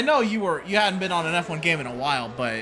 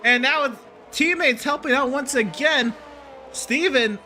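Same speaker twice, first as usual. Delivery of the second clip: motorsport commentator; 6 semitones higher than usual. Acted. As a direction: shout the spin, then keep calling. Live race play-by-play.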